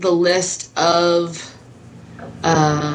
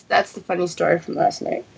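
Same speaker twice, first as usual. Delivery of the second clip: usual speaking voice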